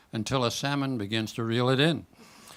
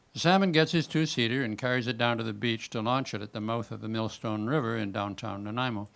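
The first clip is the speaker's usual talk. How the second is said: usual speaking voice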